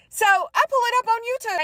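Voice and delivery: normal talking voice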